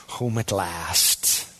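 whispered-soft contentment, very slow. Pretend. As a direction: let it fade out like a sigh of peace. Repeat, more hushed.

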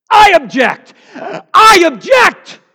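full-throated, high courtroom shout; shouted objection. Acted. Jesus as defense attorney objecting to the prosecutor, shouting back. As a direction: bellow it out, dragging the repeat. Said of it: "he shouts back".

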